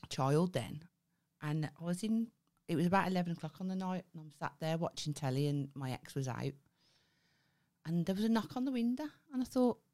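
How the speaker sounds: ordinary talk, nothing marked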